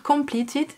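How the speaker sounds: "completed" said the French way, kom-plee-tee; French-accented English. Shown as a demonstration. Telling the app her to-do item is done, "completed" in French-accented English. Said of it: "Vous noterez l'accent".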